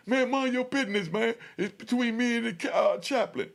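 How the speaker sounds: high, loud annoyed shout; yelling back. Retold or imitated. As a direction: bark them off, it's his business. Inmate yelling back at others.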